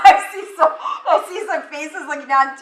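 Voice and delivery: laughing through the words; chuckling at guilty faces in the room. Real, not acted. Speaker to audience, laughing at their guilty faces.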